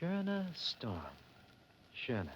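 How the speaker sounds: savoring the name. Acted. Publicist unveils a great discovery's name, savoring it. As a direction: relish the sound of the name. Demonstrating the stage name again.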